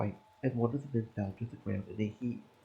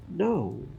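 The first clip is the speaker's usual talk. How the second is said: shocked no; 6 semitones higher, 6 dB louder than usual. Acted. Plan collapses, outburst.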